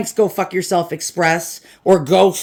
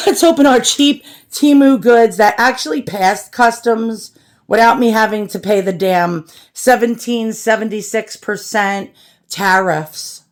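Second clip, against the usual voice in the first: normal talking voice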